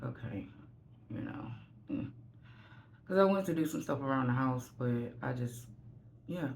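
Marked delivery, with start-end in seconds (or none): 0.0-2.1 soft, trailing-off sigh of giving up; mumbled resignation. Retold or imitated. Drained woman mumbling to herself after shutting down. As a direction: let it fade into a defeated murmur.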